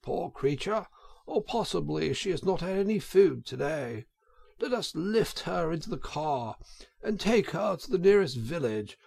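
a sympathetic motorist fussing gently; kindly gentleman. A narrator's reading. Gentleman voiced in story.

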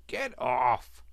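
spluttering with a mouthful; mouth full. A narrator's reading. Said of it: "spluttered the Rat with his mouth full".

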